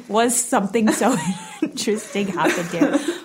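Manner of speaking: repeating the question through laughter; teasingly parroting her own line while laughing; giggling as she says it again; laughing mock repeat. Shown as a demonstration. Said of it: "That wasn't a full sentence".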